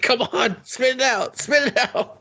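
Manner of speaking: high, pushy mock coaching; mock high urging. Urging the girl to spit out glass, high mock yell. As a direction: cheer her to spit it out. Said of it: "It's like".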